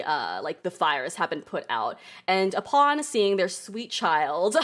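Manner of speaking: usual speaking voice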